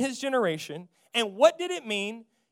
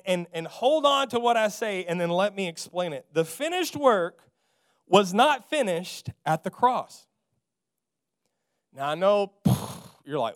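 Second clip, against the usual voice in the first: ordinary talk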